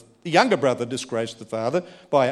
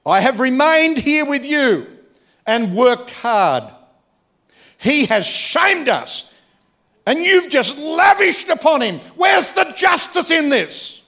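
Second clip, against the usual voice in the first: much higher, louder shout, slow on worked hard and justice; furious tirade; 13 semitones higher, 7 dB louder than usual. Acted. Older brother accusing his father of injustice, furious shout. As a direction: rage at the unfairness, building to a shout.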